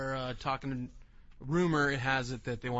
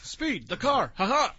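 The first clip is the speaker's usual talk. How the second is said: cartoon villain laugh; 8 semitones higher, 7 dB louder than usual. Acting cartoon characters.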